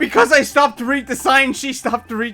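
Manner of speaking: high, loud, astonished outburst; loud disbelief. Real, not acted. Player realizes the rival copies her, loud astonished outburst.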